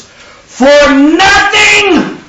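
For nothing, with nothing drawn out slow and high; emphasis on nothing. Shown as a demonstration. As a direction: stretch the word nothing long and loud. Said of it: "I'm gonna try to use the emphasis".

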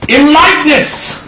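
high, booming emphasis, drawn out; slow, forceful burst on "In likeness"; projected from the diaphragm. Shown as a demonstration. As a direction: belt out the underlined words. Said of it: "I know when to use my diaphragm".

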